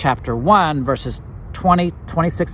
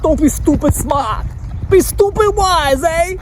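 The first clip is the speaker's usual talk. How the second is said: hammed-up Brooklyn accent, much higher; 11 semitones higher, slower than usual. Joking preacher to his viewers in a Brooklyn accent. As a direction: play a wiseguy from Brooklyn in a Brooklyn accent. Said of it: "I gotta do my Brooklyn accent".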